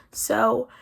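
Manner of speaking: ordinary talk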